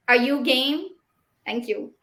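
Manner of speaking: ordinary delivery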